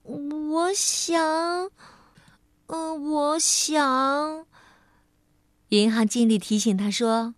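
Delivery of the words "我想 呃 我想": hesitant. Acted. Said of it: "糊涂小姐看着她说".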